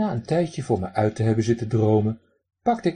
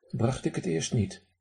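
usual speaking voice

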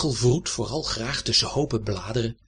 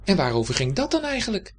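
much higher and fast, chatty cat; 7 semitones higher, faster than usual. A narrator's reading. Cat teasing the hedgehog, high quick voice. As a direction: rattle it off as the curious cat. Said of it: "ging Dibbes door".

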